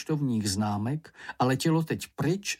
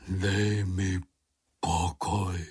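low, weary moan; 5 semitones lower, much slower than usual. A narrator's reading. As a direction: moan it low, wanting to be left alone. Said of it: "zaúpěl Bambul". (hluboce, zničeně zaúpět)

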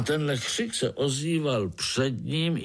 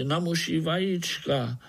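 higher-pitched matter-of-fact explaining; lightly voiced spider; 7 semitones higher than usual. A narrator's reading. Spider tells the ants of Artur's craving, explaining. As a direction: explain it innocently, slightly raised in pitch.

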